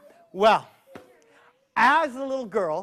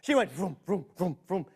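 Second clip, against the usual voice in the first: ordinary delivery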